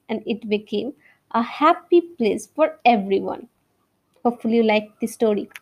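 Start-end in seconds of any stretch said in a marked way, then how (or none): 4.2-5.5 whispering. Real, not acted. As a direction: whisper it fast and breathy. Quick whispered aside.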